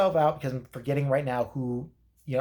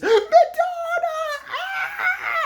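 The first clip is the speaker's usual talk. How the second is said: very high ecstatic shriek of the name; overexcited shriek; 5 dB louder, slower than usual. Retold or imitated. Comic voicing the overexcited co-host shrieking [name]'s name. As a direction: scream it like a superfan. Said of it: "the overexcited blonde bitch goes".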